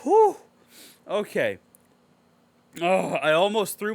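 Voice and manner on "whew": big exhale. Real, not acted. Calming down after the rant.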